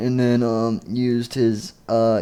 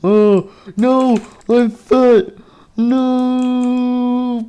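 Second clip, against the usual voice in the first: high, loud wail with long final no; mock dismay; 12 semitones higher, 4 dB louder, much slower than usual. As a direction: cry out in hammed-up horror. Character is inflated, cries out.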